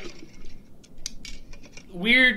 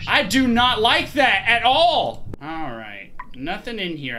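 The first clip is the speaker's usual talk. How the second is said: ordinary delivery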